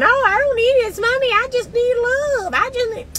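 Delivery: high, quick mocking woman's voice. Mocking women who say they just need love, high and quick. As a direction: mimic her sweetly and high. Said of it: "by saying".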